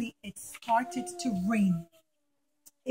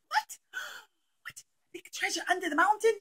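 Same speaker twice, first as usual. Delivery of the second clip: gasping surprise; 8 semitones higher than usual. Acted. People hear of treasure.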